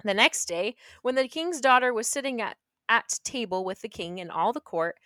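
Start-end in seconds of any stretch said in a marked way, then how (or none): none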